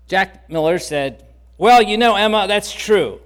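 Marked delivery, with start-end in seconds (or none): 1.6-3.2 cocky. Retold or imitated. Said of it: "with kind of a bit of cockiness".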